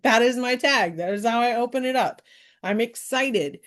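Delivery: usual speaking voice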